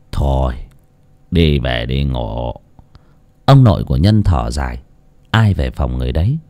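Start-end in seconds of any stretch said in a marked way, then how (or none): 0.1-2.5 put-on weary old man. Grandfather ends the night wearily.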